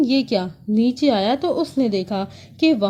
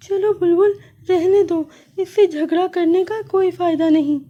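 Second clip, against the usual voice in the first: high little sparrow voice, giving up; resigned sparrow; 7 semitones higher than usual. Acted. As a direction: pull her friend away.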